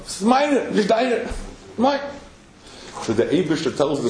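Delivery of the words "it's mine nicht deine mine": higher, insistent mine, not yours; possessive claim. Acted. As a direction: stake the claim like a stubborn child. Sultan claims the chicken.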